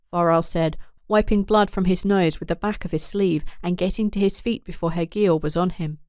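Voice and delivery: ordinary delivery, nothing marked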